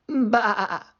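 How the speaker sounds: high bleating baa; short high sheep's baa. Acted. Sheep answers Sam.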